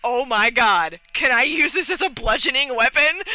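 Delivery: high, loud burst of glee; re-enacted giddy excitement; excited realisation. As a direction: blurt out a thrilling idea. Re-enacting excited idea for new weapon.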